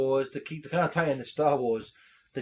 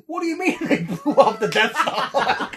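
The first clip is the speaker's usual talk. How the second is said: higher, laughing disbelief with a pause; quoting Vader; 7 semitones higher, 3 dB louder than usual. Retold or imitated. Host quoting Vader's pause, higher and laughing. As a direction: lean on the pause, incredulous. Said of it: "just that slight pause".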